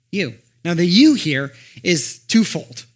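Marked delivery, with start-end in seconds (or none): 0.8-1.1 "you" high and loud; stress on YOU. Shown as a demonstration. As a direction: punch the word "you". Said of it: "as in it's emphatic".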